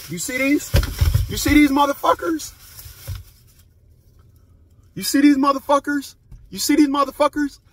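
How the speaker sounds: mock squeaky brag; high taunting voice. Vlogger brags about his snacks to viewers, high and teasing.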